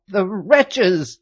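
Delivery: high, loud furious cry; old nurse cursing the arresters; indignant outburst. A narrator's reading. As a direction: spit it at the enemies. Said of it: "stamping her foot".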